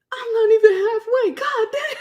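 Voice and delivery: very high, loud comic complaint; dismayed wail. Retold or imitated. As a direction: wail it in mock despair, half laughing. Looking back, dismayed at distance.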